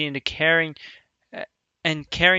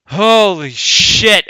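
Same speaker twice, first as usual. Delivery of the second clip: stunned; 5 semitones higher, 10 dB louder, much slower than usual. Real, not acted. Stunned reaction to dark line.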